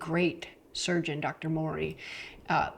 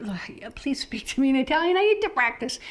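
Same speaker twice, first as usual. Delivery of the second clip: higher, mock-frustrated pleading; groan, then rise to a high, louder plea; exasperated plea; 6 semitones higher, 4 dB louder than usual. Customer begging shopkeeper to speak Italian, mock exasperation. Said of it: "And I'm like".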